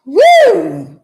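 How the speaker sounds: high, loud church whoop; a shout of praise, high and loud; joyful holler. Real, not acted.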